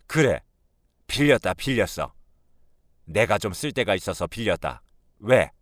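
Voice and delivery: grudging, combative admission; mildly acted defiance, ending loud; defiant mother-in-law. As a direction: throw the admission back at him.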